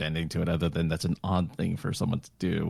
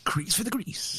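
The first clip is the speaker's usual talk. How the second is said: whispered echo; 14 semitones higher, 5 dB quieter, slower than usual. Real, not acted. Echoing the rhyme in delight.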